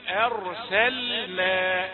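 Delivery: أرسلنا said very slowly, each letter clear; careful correct reading. Shown as a demonstration. Teacher modelling careful recitation. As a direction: spell out أرسلنا slowly and precisely. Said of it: "همزة رقيقة".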